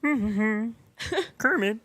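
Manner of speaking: high puppet frog voice; Kermit impression. Podcaster staying in Kermit voice, high puppet frog. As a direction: keep the frog voice going. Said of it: "that's like Kermit".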